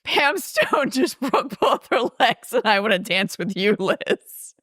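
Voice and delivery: high, giggle-squeezed quote; line squeaked out through suppressed laughter; laughing retold quote. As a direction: try to deliver it while cracking up. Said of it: "Railings comes in and says".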